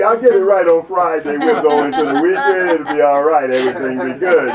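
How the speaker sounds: loud, high, laughing bargaining with God; pleading sinner. Acted. Preacher voicing sinner begging God for a day off, loud and laughing.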